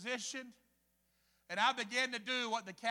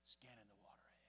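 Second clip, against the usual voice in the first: breathy hush, barely voiced; whisper; 14 semitones lower, 30 dB quieter, faster than usual. Preacher whispering how the guide scans the water. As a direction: whisper it like watching the river close. Guide scanning the river quietly.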